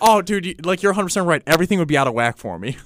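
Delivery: normal talking voice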